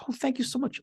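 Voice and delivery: very high, soft thankful voice; mock gratitude. Poor person thanks the giver, mock gratitude.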